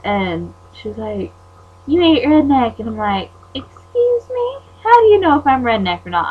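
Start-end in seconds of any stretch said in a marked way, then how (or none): 1.9-2.8 louder, higher imitation of a rival; mock taunt. Quoting Carly's taunt. 3.5-6.3 indignant comeback. Retold or imitated. Her indignant comeback to Carly.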